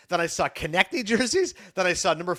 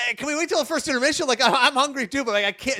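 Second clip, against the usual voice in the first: higher, hurried mock plea; acted pleading; 7 semitones higher than usual. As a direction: beg to put it off, lightly hammed-up. Retold plea to skip tenders queue.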